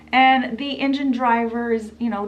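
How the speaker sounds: usual speaking voice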